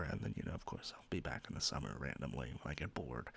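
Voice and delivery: a half-swallowed afterthought; quiet aside. Real, not acted. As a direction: mumble it off to the side. Drops to a low aside.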